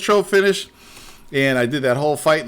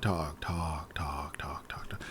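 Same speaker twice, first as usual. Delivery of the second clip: sinking from normal to a whisper; fading talk; 14 semitones lower, 18 dB quieter, slower than usual. Retold or imitated. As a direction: trail off, worn out by it. Mocking endless dialogue, fading out.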